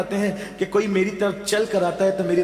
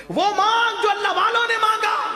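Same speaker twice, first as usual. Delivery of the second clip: much higher, loud ecstatic cry; preaching shout; 14 semitones higher, 4 dB louder than usual. Real, not acted. Preacher urging listeners to ask like the saints, in a high ecstatic shout. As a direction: lift the whole crowd with it.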